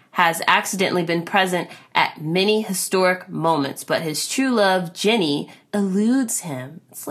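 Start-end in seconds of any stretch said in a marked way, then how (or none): none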